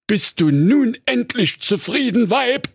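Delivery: high, put-on voice of a grumbling husband; henpecked husband. A narrator's reading. Digger driver complaining to his wife, high grumbling husband voice.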